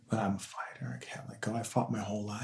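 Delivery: whispered defiance. Real, not acted. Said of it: "whispered defiance".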